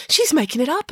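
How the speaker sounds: put-on high, sharp accusing girl's voice. A narrator's reading. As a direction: point the finger, pitched high. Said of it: "accused Matilda".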